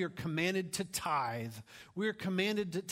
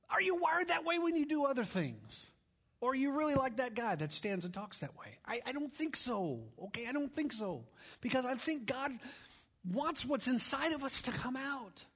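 preaching at full force; 9 semitones higher than usual. Acted. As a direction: exhort the congregation with fire.

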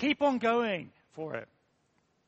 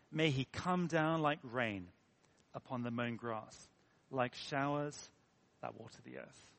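slow, low and hushed, fading softer; reverent verse reading; 6 semitones lower, 5 dB quieter than usual. Acted. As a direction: savour each phrase of the psalm softly. Savours a lovely verse.

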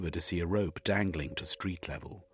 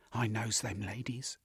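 slightly hushed, higher aside; muttered; 4 semitones higher, 4 dB quieter than usual. A narrator's reading. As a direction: mutter it under your breath. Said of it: "she muttered".

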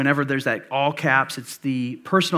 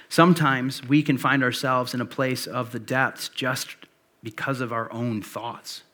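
ordinary talk

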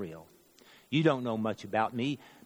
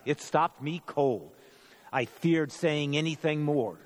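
ordinary delivery, nothing marked

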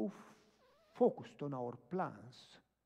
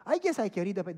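loud, raised voice of the lady; grudging agreement; 5 semitones higher, 11 dB louder than usual. Retold or imitated. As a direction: give in noisily as the reluctant lady. Woman gives in, retold.

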